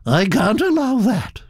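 put-on high, gossipy old aristocrat voice, pitched well up; elderly Duchess. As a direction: chatter on like a grand old lady.

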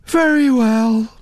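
slow, high, yawning assent; bored, stretched-out acceptance; yawned reply. A narrator's reading. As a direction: agree through a lazy yawn. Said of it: "Naomi yawned".

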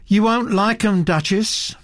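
a mild note of warning; warningly. Acted. Naomi warning the Duchess about her paintings, mildly. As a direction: tip her off, lightly.

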